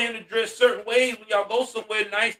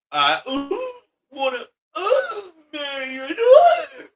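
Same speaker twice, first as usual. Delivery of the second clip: put-on halting teen boy, voice shooting up to a squeal; voice cracking; 8 semitones higher than usual. Teen asks the father for his daughter's hand, voice cracking. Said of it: "His voice be going out".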